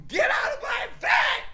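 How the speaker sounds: high, shrieking "Get out of my bed! Get out of here!", laughing at the end; mocking shriek. Mocking his rival's calm claim with a shrieking impression. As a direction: ham up a hysterical yell. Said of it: "If you call that fucking calm".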